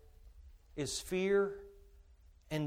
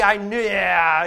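mock doubter; 11 dB louder, slower than usual. Mocking doubter.

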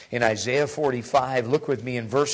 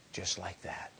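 whispered softly; preacher whispering about mercy; 6 semitones lower, 17 dB quieter than usual. Real, not acted. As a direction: drop to a whisper. Preacher drops voice.

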